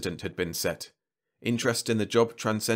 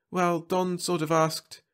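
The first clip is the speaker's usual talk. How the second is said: higher, slow and hesitant; lightly voiced woman; 9 semitones higher, slower than usual. A narrator's reading. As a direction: let him down gently, drawn out. Fern declines again.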